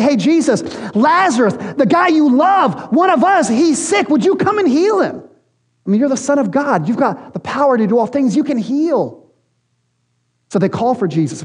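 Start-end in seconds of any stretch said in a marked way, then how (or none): 0.0-9.2 higher, urgent pleading voice, rising to a high cry; pleading messenger. Retold or imitated. Sisters through a messenger beg Jesus to heal Lazarus, high urgent plea. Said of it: "they say to a messenger to Jesus".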